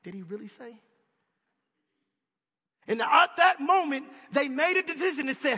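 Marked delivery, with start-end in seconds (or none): 0.0-0.8 much softer, sly hush; Satan whispering. Retold or imitated. Satan whispering doubt to Adam and Eve, sly hush. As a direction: hush it like a tempter in the ear. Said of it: "Satan whispered those simple words".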